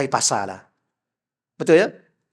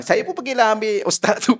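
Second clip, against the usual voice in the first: usual speaking voice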